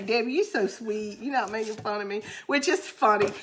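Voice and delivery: usual speaking voice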